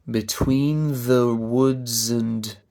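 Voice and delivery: slow verse, weak "the" and "and"; slow, joined-up "Between the woods and"; metrical stress. Shown as a demonstration. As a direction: draw out "Between the woods and" on the iambic beat. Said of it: "'cause in the unstressed position".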